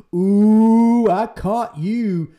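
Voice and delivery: high, loud, drawn-out mock triumph; gloating gotcha. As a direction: sneer it like a smug judge catching a sinner. Mocking a judgmental churchgoer.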